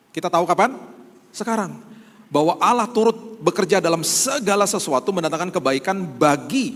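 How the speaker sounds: high, loud, driving sermon voice; fervent preaching. Acted. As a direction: preach it out, pressing every phrase home. Preaching at full force. (berkhotbah dengan suara tinggi dan keras penuh semangat)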